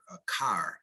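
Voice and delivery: Midwestern "car" with a full R; Midwestern accent. Shown as a demonstration. Teacher showing Midwestern dialect, Midwestern accent. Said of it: "You might hear the dialect".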